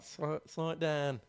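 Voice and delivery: slow, drawn-out Yorkshire voice; Yorkshire accent. Retold or imitated. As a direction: copy the Yorkshire official, slowly. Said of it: "Steve Bracknell".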